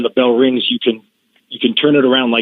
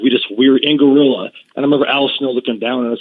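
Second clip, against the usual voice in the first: ordinary talk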